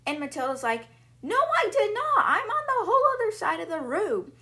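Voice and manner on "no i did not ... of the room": high, loud squeaky protest; a girl's high, loud denial to her father; indignant girl. Retold or imitated. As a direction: push back like a wronged little girl. Matilda protests accusation.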